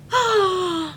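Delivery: high, drawn gasp of awe; real amazed intake of breath; delighted gasp. Real, not acted. Sees the gifted ears.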